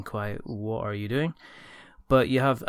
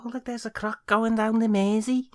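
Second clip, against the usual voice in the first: high-pitched attempt at a Scouse accent; Liverpudlian accent; 11 semitones higher, 4 dB louder than usual. Having a go at spotting a croc in Liverpool, Liverpudlian accent. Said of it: "I could probably try a Liverpudlian accent".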